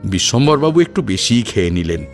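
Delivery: usual speaking voice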